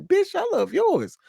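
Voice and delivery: ordinary talk, nothing marked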